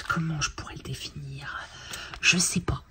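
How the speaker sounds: whispered musing; hushed musing aside. Real, not acted. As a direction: wonder aloud in a whisper.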